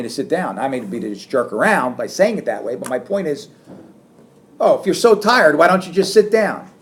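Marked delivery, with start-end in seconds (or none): 4.6-6.7 high, loud mocking offer; sarcastic. Shown as a demonstration. Teacher showing sarcastic way to say sit down. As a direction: sneer the invitation to embarrass. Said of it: "I'm saying it in a sarcastic way".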